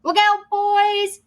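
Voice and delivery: high, slow warning; order. Acted. Captain warning his crew of the giant, high order. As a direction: call the warning out. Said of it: "ordered the captain".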